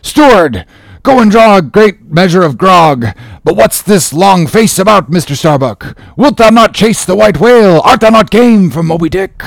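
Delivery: high, forceful challenge; commanding call. Acted. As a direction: order and challenge them loudly. Ahab orders and challenges crew.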